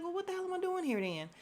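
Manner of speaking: high-pitched, quick retort; mock indignant question. [name] asking why he is there, mock indignant.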